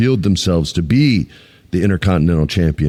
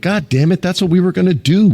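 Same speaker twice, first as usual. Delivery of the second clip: mock frustrated writer; 9 semitones higher than usual. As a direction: play a writer whose secret got spoiled. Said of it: "someone in creative went".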